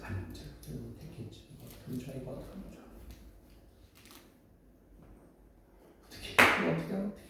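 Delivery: softly, barely voiced worried murmur; anxious hush. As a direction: fret under your breath, lost. Mary in panic, hushed. (조용히 안절부절)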